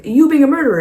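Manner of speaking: loud, high mock accusation; arguing accusation. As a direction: fire back in a hammed-up quarrel. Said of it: "as they're arguing".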